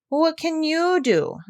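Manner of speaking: mock sobbing piglet complaint; whimpering pig, lightly voiced; whiny crying. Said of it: "beginning to cry again".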